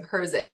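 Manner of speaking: Herzig spoken clearly, HER-zig, stress on the first syllable; saying her last name. Shown as a demonstration. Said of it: "not sure how to pronounce your last name".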